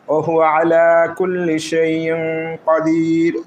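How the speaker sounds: slow, drawn-out chanted recitation of ওহু আলা কুল্লি শাইইন কদির; slow Quran recitation. Shown as a demonstration. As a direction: intone the verse slowly, like in prayer. Teacher recites the full verse. (ধীরে, টেনে টেনে তেলাওয়াত)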